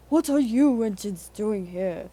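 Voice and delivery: loud, angry hiss at intruders; Henry's hiss. A narrator's reading. Henry scolds the visiting engines, loud and hostile. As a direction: drive them out of his shed. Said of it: "hissed Henry".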